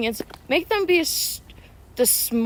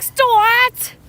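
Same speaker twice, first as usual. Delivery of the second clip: high, louder minion cry of "Stuart!"; upset minion yell; 8 semitones higher, 4 dB louder than usual. Retold or imitated. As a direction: squeal "Stuart!" like an offended minion. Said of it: "He's like".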